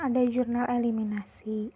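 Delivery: normal talking voice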